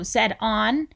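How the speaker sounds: plain reading voice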